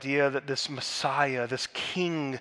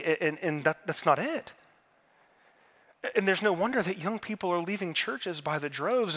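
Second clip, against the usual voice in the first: normal talking voice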